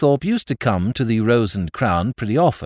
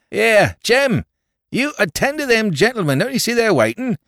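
higher, louder call across the bar; calling to the potman; 13 semitones higher, 4 dB louder than usual. A narrator's reading. Landlord calls the potman to serve customers, loud. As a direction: shout orders over the counter.

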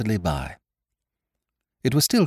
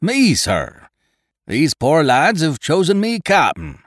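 high, loud pirate voice; Long John's loud, courteous reply; pirate leader answering; 9 semitones higher, 5 dB louder, slower than usual. A narrator's reading. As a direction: hail back as a wily pirate. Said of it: "Long John answered for himself".

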